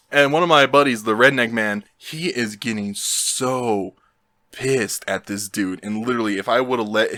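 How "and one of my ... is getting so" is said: ordinary delivery, nothing marked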